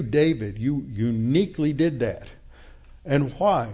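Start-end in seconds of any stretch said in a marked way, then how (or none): none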